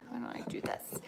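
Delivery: whispered aside to herself. Real, not acted. Host whispering an aside while adjusting the camera. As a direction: drop to a breathy whisper while fiddling with the camera.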